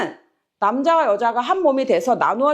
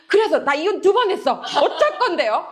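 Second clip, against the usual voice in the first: high, defiant retort with laughter underneath; mock indignation; 8 semitones higher than usual. Twice-divorced listener answering the preacher, mock-indignant. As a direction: play the affronted listener for laughs. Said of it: "화가 너무 난대요".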